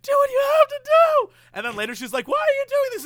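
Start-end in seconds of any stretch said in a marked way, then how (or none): 0.0-1.3 high, loud mock wail; hammed-up crying. Wife tells her husband, loud high-pitched mock crying. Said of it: "she's always crying". 2.3-3.0 high, loud weepy wail; mock-sob the question at full pitch; hammed-up crying. Wife questions her husband later, high loud mock crying. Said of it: "she's always crying".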